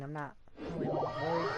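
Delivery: ordinary talk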